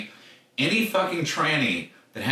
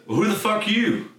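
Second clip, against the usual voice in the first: higher-pitched mock challenge; 10 semitones higher than usual. Redneck challenges him in retold fight.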